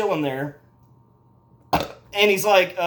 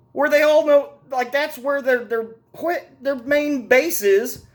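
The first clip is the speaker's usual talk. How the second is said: much higher, louder disbelieving outburst; incredulous rant; 8 semitones higher, 3 dB louder than usual. Real, not acted. Mocking the crew for running to the tire shop, higher and louder. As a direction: shout up in disbelief at who they'd call.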